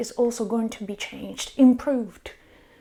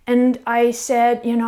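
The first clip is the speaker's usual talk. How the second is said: ordinary delivery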